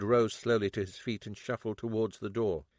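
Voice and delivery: plain reading voice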